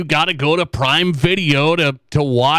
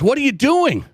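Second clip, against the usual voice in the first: lightly voiced puzzled daughter, higher; a hint of a young girl asking, pitched up; mock-innocent kid asking dad; daughter's question; 8 semitones higher than usual.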